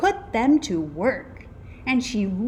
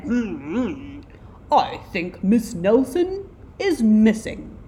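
put-on detective voice, louder on the verdict; pondering detective; 3 semitones higher, 5 dB louder than usual. A narrator's reading. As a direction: mull it over, then announce the obvious. Slow-witted detective states the obvious.

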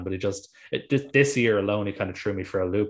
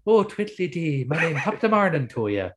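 put-on high, lilting caricature Irish accent; mocking, sing-song fake Irish; mock stage Irish; 7 semitones higher than usual. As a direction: ham up a twee stage-Irish greeting. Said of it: "like, if you came and going".

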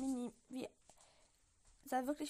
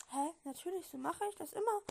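higher, louder puzzled reply; mildly bewildered, pitched up; slightly confused; 5 semitones higher, 5 dB louder than usual. A narrator's reading. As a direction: answer as if baffled by the question. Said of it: "etwas verwirrt".

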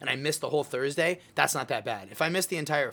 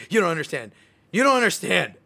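building into a louder, higher mock outburst; frustrated son, repeating himself ever louder at mom; mad at mom; 4 semitones higher, 7 dB louder, faster than usual. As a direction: let the frustration climb on each repeat. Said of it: "start getting mad at my mom".

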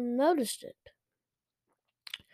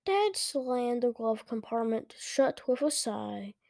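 plain reading voice

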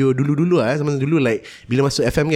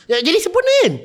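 awkward high reply; faster than usual. Retold or imitated. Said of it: "I'm left there like".

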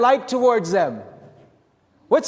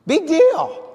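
high, louder mocking dismissal; a big sarcastic eye-roll of a line; sarcastic scoff; 7 semitones higher, 3 dB louder than usual. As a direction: scoff at it with a shrug. Sarcastic dismissal after Luke quote.

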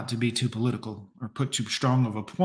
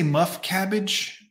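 "Muff cabbage" slow, in a lightly faked Jersey accent; 5 semitones higher, slower than usual. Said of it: "in the Jersey accent".

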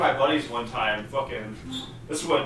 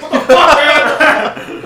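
high, loud cry of disbelief; yelling what the fuck in disbelief; shocked outburst; 12 semitones higher, 13 dB louder, much slower than usual. Retold or imitated. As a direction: blurt it out in stunned outrage. Retelling shock at the salesman.

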